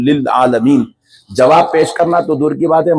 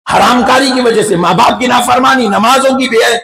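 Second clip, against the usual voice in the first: building full-force preaching; 7 semitones higher than usual. Real, not acted. Preacher declaring God will not punish the sinners, building to full force. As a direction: build the list to a ringing climax, stretching each ending.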